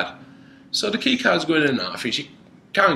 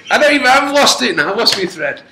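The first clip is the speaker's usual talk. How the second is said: usual speaking voice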